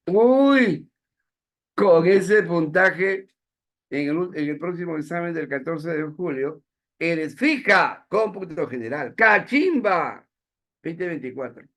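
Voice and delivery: high, loud, slow mocking praise, dripping with irony; ironic praise. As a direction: sneer out fake admiration of a terrible score. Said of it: "Esa es una ironía".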